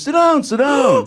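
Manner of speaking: very high, drawn-out invitation; high imitated star beckoning. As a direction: call someone over in a high, slow put-on voice. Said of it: "he just goes".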